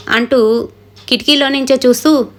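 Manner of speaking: usual speaking voice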